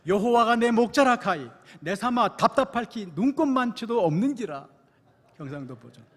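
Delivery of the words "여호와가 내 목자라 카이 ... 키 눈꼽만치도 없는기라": louder, higher Gyeongsang dialect retake; stronger Gyeongsang dialect. Retold or imitated. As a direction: push the Gyeongsang accent harder this time. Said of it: "다시 한번".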